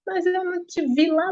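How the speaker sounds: high, sharp accusing question; mock jealous husband confronting wife. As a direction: throw the suspicion at her. Husband accusing her at the door.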